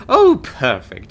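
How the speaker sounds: loud, drawn-out gush of approval; long, loud, gleeful "oh"; delighted. Acted. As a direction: gush slowly with relief and delight. Recruiter delighted the offer is accepted.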